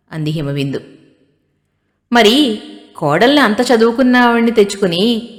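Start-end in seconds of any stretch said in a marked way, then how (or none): 2.1-5.3 louder, higher sneering jab; mocking. Groom's sister taunting the bride's family, mocking. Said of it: "అంటూ హేళనగా అంది ఆమె".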